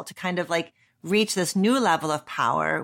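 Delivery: ordinary delivery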